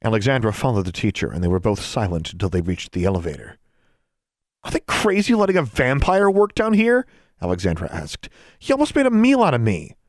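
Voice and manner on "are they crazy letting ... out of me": put-on much higher, louder girl voice, indignant. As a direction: protest like a rattled schoolgirl. Girl voiced, upset after vampire.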